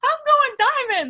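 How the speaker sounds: very high, loud, emotional burst; tearful joy breaking through; overjoyed cry. Retold or imitated. On her knees seeing snow, crying out in high joy. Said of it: "bawling my eyes out".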